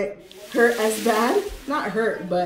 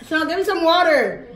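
calling out; 7 semitones higher, 4 dB louder than usual. Real, not acted. Calling sister for water.

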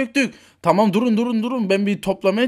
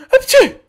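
high, loud sneeze; 10 dB louder than usual. Acted. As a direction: burst out in a squeaky sneeze. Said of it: "o niye hapşırıp duruyor". (hapşırma)

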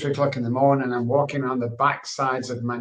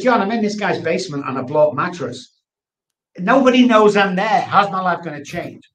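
exasperated outburst; 6 semitones higher, 7 dB louder than usual. Retold or imitated. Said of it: "You know, I said".

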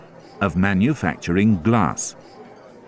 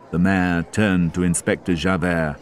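plain reading voice

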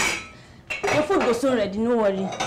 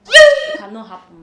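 high, loud outburst of refusal; outraged refusal; 11 semitones higher, 11 dB louder than usual. Acted. House help refuses madam's order to wash, loud outraged refusal. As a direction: cry it out in protest.

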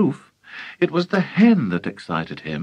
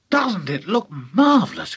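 put-on excited boy, a touch raised; mild shout; 6 semitones higher than usual. A narrator's reading. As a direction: call out with delighted pride, pitch lifted. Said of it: "George shouted".

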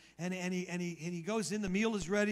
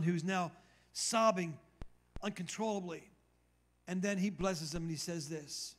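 normal talking voice

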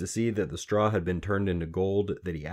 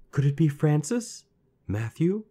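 put-on high, louder questioning girl; feigned guessing; 9 semitones higher, 4 dB louder than usual. A narrator's reading. Queen pretending to guess for the little man, questioning. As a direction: offer each name like an innocent guess.

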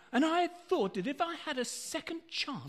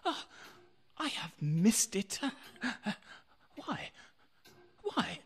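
usual speaking voice

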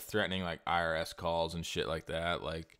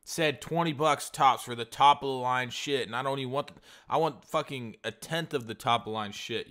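higher, louder incredulous yelling; angry rant; 6 semitones higher, 4 dB louder than usual. Retold or imitated. Buyer yelling at Fiverr seller about his listing, mock fury. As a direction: tear into him over the listing.

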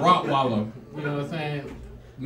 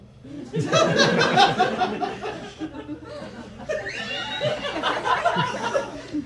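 big high burst of laughter. Real, not acted. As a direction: crack up at your own line. Big laugh at own joke.

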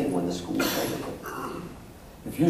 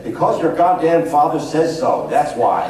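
loud, higher booming bellow; booming shout; 5 semitones higher, 7 dB louder than usual. Retold or imitated. Psychologist yelling at his sons, booming and loud. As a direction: boom it out like an exasperated dad. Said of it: "I'm gonna tell you exactly what he said".

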